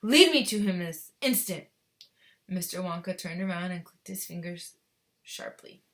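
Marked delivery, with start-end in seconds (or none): 0.0-1.7 loud, imperious demand; angry command. A narrator's reading. Mother demanding to see her boy, commanding. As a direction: order him loudly and at once.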